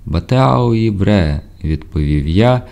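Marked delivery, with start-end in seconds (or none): 0.1-1.4 raised, slow answering hail; called reply. A narrator's reading. Sailor answering a hail through fog, drawn-out call. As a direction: stretch the syllables out, calling back.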